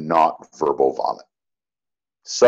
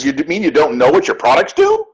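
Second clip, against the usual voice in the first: a mildly irritated, faster incredulous question; woman at dinner, irritated at the vague answer; irritated disbelief; 7 semitones higher, 4 dB louder, faster than usual. Retold or imitated. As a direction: let the stranger sound put out by the dodge. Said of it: "this irritates the woman".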